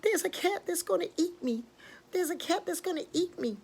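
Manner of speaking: high, panicky bird voice; frightened pigeon. Acted. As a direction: plead in a high voice, acting terrified. Frightened bird warns of cat.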